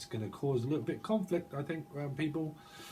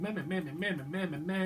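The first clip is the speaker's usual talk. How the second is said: mock babbling; 7 semitones higher than usual. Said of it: "someone, like, going".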